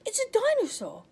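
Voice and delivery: high, loud alarmed cry; a child's thrilled yell; excited shout. Acted. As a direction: blurt it out in a fright. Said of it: "shouted Oliver".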